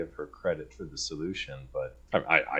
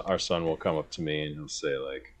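ordinary delivery

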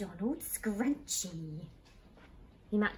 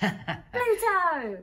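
high, loud delighted cry; excited; 9 semitones higher, 8 dB louder than usual. Real, not acted. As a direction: burst out with joy. Excited at seeing Pluto ears.

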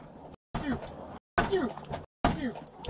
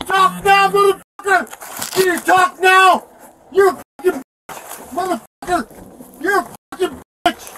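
screaming in real rage, louder and higher; furious shout; 5 semitones higher, 5 dB louder than usual. Real, not acted. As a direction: bellow it at the cop with all your fury. Arrestee screaming at police officer.

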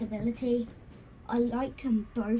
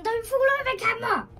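sudden high panicked yelp; alarmed yelp; 12 semitones higher, 8 dB louder, faster than usual. Real, not acted. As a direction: blurt it out in alarm. Toy falling, calls to camera.

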